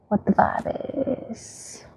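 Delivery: unvoiced whisper. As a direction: breathe it as a whisper. Whispered aside.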